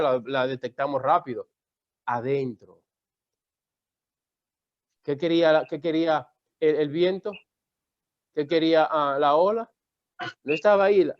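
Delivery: normal talking voice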